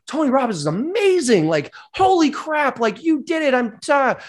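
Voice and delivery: high-pitched, gushing mock amazement; hammed-up euphoric awe; starstruck elation. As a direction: gush like a freshly converted fan. Acted elation after crossing coals.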